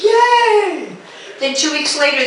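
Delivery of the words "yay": mock delight. Kids will clean the stove.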